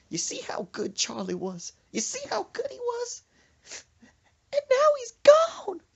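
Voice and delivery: hammed-up wail rising into loud sobs; blubbering. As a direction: build from high whimper to bawling grief. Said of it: "five more minutes of that blubbering".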